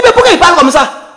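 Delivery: mock Arafat laughing. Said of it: "Il dit".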